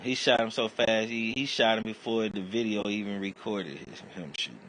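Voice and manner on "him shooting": normal talking voice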